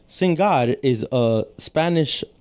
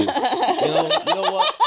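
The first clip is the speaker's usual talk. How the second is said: squeaky high voice. Acted.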